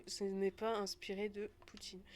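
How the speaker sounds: normal talking voice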